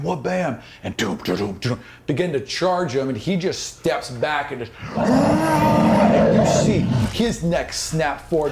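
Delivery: normal talking voice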